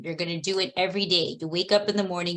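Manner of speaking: usual speaking voice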